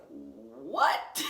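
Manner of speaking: low, drawn-out stunned what; shocked what. Retold or imitated. Seeing her bloody jeans, stunned. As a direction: react in disbelief at the blood.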